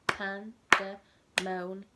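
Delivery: Pandemonium in five slow separate beats, each syllable clapped; clapping out syllables. Shown as a demonstration. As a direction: say Pandemonium very slowly, one clap per syllable. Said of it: "Shall we clap it?".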